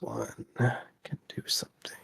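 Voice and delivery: hushed, breathless whisper. Acted. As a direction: whisper it like a secret. GM whispers eerie scene.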